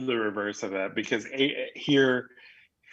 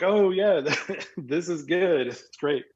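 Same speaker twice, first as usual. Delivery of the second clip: fake enthusiastic praise; 7 semitones higher, slower than usual. Guest to host, faking delight over dry barbecue, overly enthusiastic. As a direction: pretend to love the dry meat with a laugh.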